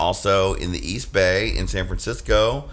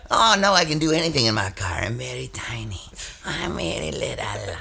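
Midge voice; 5 dB quieter than usual. Retold or imitated. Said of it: "And then the Midge is like".

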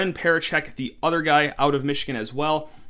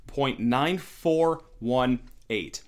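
slow dramatic reveal; much slower than usual. Real, not acted.

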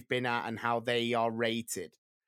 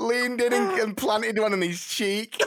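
higher, louder, laughing through it; 12 semitones higher, 9 dB louder than usual. Real, not acted. Retelling the teacher's kiss, laughing loudly. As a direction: giggle through the words.

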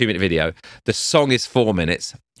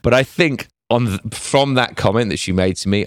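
normal talking voice

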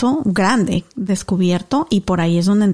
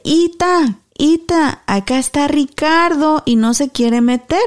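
calling out to grandma; 6 semitones higher, slower than usual. Retold or imitated. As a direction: call grandma from outside. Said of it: "le grité a mi abuelita".

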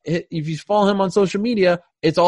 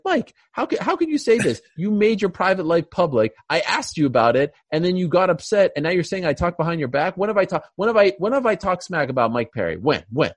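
worked up; 2 semitones higher than usual. Retold or imitated. Host retelling his reaction to a fighter's accusation, worked up. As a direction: plead your case, getting worked up. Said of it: "Sorry, I got worked up".

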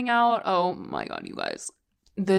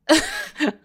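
short incredulous laugh; disbelieving laugh; 8 semitones higher than usual. Real, not acted.

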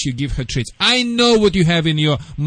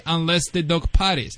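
ordinary talk

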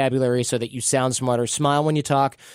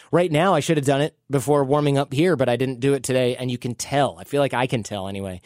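usual speaking voice

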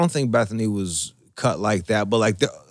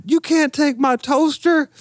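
much higher, slow mock protest; imitating upset girl; 13 semitones higher than usual. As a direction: whine it like a little girl losing her toaster. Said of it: "And she's like".

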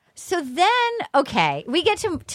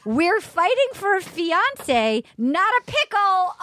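put-on slow Russian accent, a bit higher; 5 semitones higher, slower than usual. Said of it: "and she says".

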